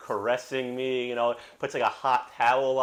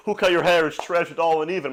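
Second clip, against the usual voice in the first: louder, higher mock outrage; raised-pitch retold disdain; imitated roasting; 5 semitones higher, 4 dB louder than usual. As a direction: imitate a barber trashing a haircut. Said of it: "roasting the old guy who cut your hair".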